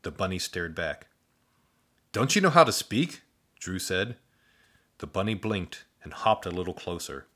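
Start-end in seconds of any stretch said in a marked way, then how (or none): 2.1-3.2 put-on higher, eager young boy; breathless thanks from a boy; boy voice, grateful. Boy speaks.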